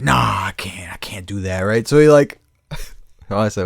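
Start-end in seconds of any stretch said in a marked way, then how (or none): 0.0-1.6 low unvoiced half-whisper; hushed mock refusal; quiet refusal. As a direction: murmur it like a scared guy backing off.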